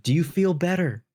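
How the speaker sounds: slow, smug question; cocky taunt. Retold or imitated. Salesman taunting a rude homeowner, slow smug question. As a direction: needle him slowly with mock concern.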